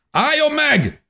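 high, loud soldier's shout; shouted halt; shouted command. A narrator's reading. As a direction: bark the order like a German soldier. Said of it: "kiáltotta valaki".

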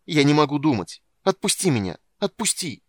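loud, high, desperate plea; desperate shout. A narrator's reading. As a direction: cry out to be let go. Palin breaks down, demands release.

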